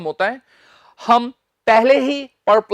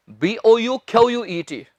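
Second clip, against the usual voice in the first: usual speaking voice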